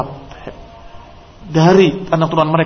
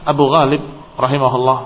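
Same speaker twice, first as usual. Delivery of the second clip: ordinary delivery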